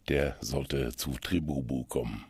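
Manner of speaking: ordinary talk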